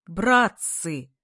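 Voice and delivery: loud drawn-out hail; full-voiced yell. A narrator's reading. Kolya on the table hails his classmates, yelling. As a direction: call out to the whole room. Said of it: "заорал во весь голос Коля".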